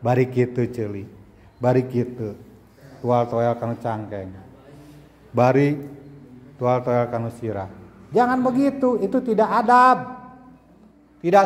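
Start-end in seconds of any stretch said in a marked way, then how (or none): 0.0-7.7 low, mumbled mock of playing around; a hint of a fidgety pupil muttering; imitating pupils. Teacher mocks pupils poking friends during prayer, low. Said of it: "mampir ke celi".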